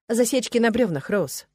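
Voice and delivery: usual speaking voice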